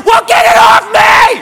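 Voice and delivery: high, loud, drawn-out mock panic; panicked shout. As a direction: yell desperately, hammed-up. Panicking, begs girlfriend to remove it.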